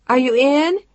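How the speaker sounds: high, loud call with a rising end. A narrator's reading. Woman calls from the back room, high and loud. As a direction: shout the question down the hall. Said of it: "she called out to him from the back".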